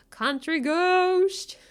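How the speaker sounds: "Country ghost" stretched very slowly through a laugh; drawn-out, laughing. Shown as a demonstration. Streamer playfully repeating a misheard joke, drawn out.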